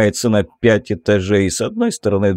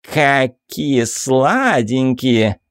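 sassy drag queen; 2 semitones higher, much slower than usual. Acted. Same camp character cooing.

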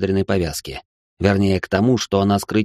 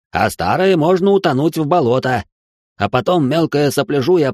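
выше и громче, ломаным языком; торжественно переводи вопль вождя; восклицание переводчика; 6 semitones higher than usual. A narrator's reading. Translator conveying chief's speech. (Херля переводит восклицание вождя, выше и громче)

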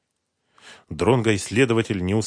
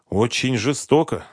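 ordinary delivery

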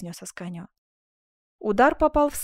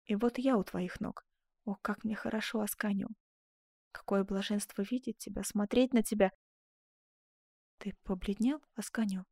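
soft, intimate love murmur; hushed and blissful; tender adoration; 7 dB quieter than usual. Acted. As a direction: speak softly, as if at a lover's feet. Duchess adoring at his feet.

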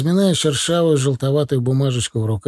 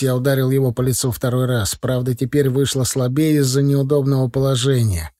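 ordinary delivery

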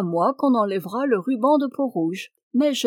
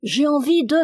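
put-on higher-pitched child's demand; crying-out children; 5 semitones higher, 4 dB louder than usual. A narrator's reading. Children crying out to Janvier during his supper, child's clamour. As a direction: call out like a pestering child. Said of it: "les enfants se mirent à crier".

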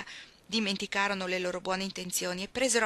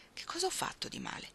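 soft, higher puzzled mutter under breath; mutter to herself; 11 semitones higher, 6 dB quieter than usual. Acted. Iggy mutters to herself after the scolding, softly puzzled. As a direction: grumble it to yourself. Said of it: "borbottò tra sé".

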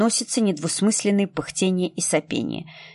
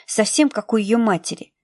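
plain reading voice

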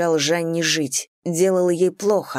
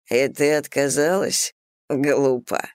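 plain reading voice

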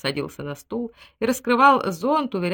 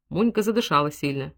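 plain reading voice

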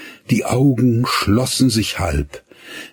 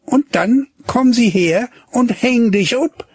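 mocking girl. A narrator's reading. Gretlieschen retorts to the boy's threat. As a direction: fire back mockingly. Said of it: "entgegnete sie".

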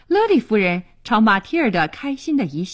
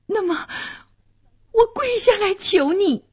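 very high, imploring voice; desperate begging; 11 semitones higher than usual. A narrator's reading. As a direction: implore him as a last resort. (绝望地跪求)

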